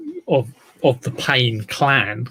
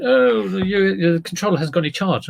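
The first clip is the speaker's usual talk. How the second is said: higher-pitched mock complaint; complaining customer; 7 semitones higher than usual. Customer tells seller about the uncharged controller, mock complaining.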